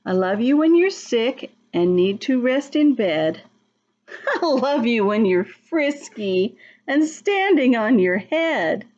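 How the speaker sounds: lilting storybook read, the last words slowed; reading to children. A narrator's reading. Teacher reads the picture book aloud, lilting. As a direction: read it sweetly to the little ones.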